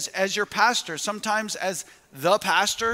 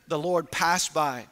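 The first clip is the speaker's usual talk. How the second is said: ordinary talk, nothing marked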